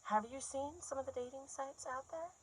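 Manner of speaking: soft, high, rising question; timid question. Acted. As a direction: ask it small and hushed, voice lifting. Frozen, repeats the question softly, then louder.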